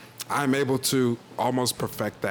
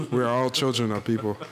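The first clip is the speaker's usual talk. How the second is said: muffled mic. Real, not acted. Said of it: "Your mic sounds really, like, muffled".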